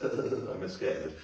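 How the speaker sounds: usual speaking voice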